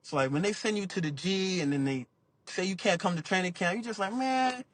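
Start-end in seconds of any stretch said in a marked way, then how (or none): 4.1-4.6 high, strained complaint climbing even higher; upset whine. Retold or imitated. Player upset at the team for sending him down, high strained whine. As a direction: whine it like you've been wronged. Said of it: "I'm just getting upset".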